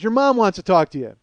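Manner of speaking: loud, high holler; shouted message. Retold or imitated. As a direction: yell it through the house. Retold shout across house.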